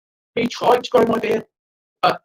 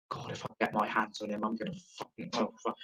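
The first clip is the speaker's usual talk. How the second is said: much softer, a mock threat under his breath; muttered threat; 10 semitones lower, 15 dB quieter than usual. Real, not acted. Comic muttering a threat at Boris Johnson, much softer. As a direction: grumble it low like a half-joking threat.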